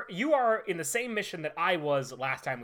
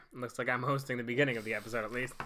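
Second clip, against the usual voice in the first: ordinary talk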